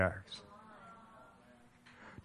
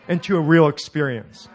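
normal talking voice

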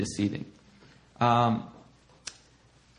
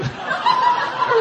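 shrill burst of laughter; high laugh. Real, not acted. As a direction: crack up at the joke. Laughs at his sheep joke.

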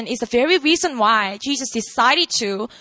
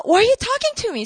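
quick, high-pitched mock bafflement; confused; 9 semitones higher, faster than usual. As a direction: ask it fast and high, puzzled. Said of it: "Samaritan woman was all confused".

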